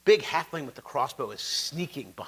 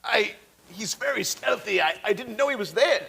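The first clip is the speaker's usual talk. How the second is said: higher, stammering excuse; flustered halfling; 5 semitones higher than usual. Acted. Halfling explains himself to his glaring boss, higher flustered stammer. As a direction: stammer it out as a caught underling. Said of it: "the halfling's like".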